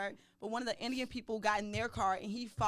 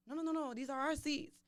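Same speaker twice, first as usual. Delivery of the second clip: higher-pitched imitation of objecting seat owners; protesting strangers; 6 semitones higher than usual. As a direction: mimic a quick, higher protest. Acting out family claiming their seats.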